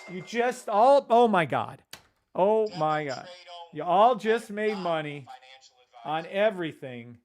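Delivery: thrilled yell at the chat; excited outburst. Real, not acted. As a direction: shout it like every trade just paid off. Trades all win, he exclaims.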